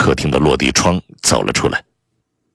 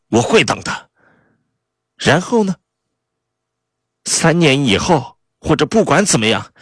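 high-pitched, taunting spouse; defiant Kay; 11 semitones higher than usual. Acted. Spouse refusing Neville a divorce, high and spitefully sarcastic. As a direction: refuse in a high voice, with biting mockery.

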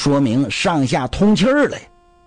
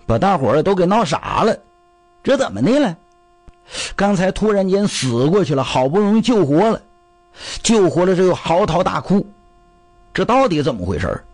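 ordinary delivery

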